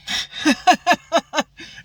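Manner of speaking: sudden high burst of laughter. Real, not acted. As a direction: crack up at your own story. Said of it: "I'm laughing at that".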